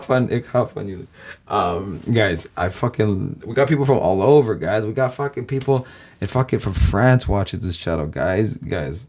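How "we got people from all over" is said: normal talking voice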